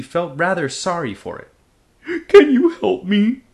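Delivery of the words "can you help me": high, loud, tearful plea from a trapped dragon; a young dragon sniffling for help. Acted. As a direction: beg for help through sniffles. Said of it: "the dragon sniffled".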